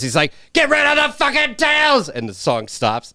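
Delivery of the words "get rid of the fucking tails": furious high-pitched shout; pissed-off Frank. Acted. Host quoting the angry mob boss from the movie, pissed-off shout. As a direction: bellow the order in a rage. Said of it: "Frank gets all pissed".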